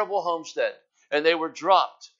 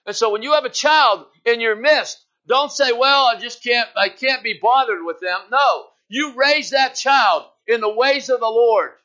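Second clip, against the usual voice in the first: raised, driving preacher's cry, pressing each line higher; preaching at full force; 7 semitones higher, 5 dB louder than usual. Acted. Preacher rising over the congregation, full-force preaching. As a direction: hammer it home to the church like a revival preacher.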